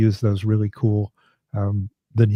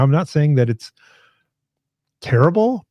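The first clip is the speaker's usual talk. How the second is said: usual speaking voice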